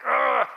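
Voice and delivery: loud, high exasperated ugh; frustrated groan. Retold or imitated. Dad groaning back at his wife's warning, exasperated ugh. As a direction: groan out your irritation at being corrected. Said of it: "because I was like".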